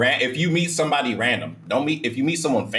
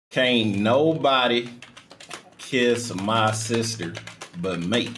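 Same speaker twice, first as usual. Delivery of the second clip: slow drawl; much slower than usual. Acted. Slow drawled joke repeat.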